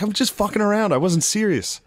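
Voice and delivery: high-pitched retold protest; pleading self-defence. Boyfriend telling girlfriend he was joking, defensively. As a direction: imitate yourself backpedalling in an argument.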